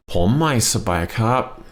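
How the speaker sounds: Pom mai sabai kap, slow, rising on mai; model for repeating. Shown as a demonstration. Teacher saying the phrase for students to repeat. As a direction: say it clearly so they can copy. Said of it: "So repeat after me".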